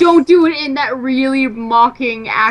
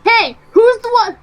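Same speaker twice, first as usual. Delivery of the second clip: excited; 9 semitones higher than usual. Real, not acted. Playful boasting challenge to friends.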